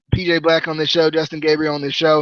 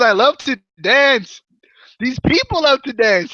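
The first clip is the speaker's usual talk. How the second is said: high, slow mock Indian accent; Mahabali Shera; 8 semitones higher, slower than usual. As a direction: gleefully overdo a bad Indian accent. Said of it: "quit with this terrible accent".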